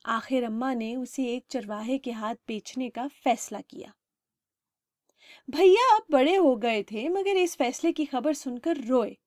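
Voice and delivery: plain reading voice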